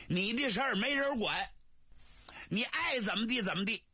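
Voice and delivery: exasperated brother. Acted. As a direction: throw up your hands at her. Li Jun exasperated with sister.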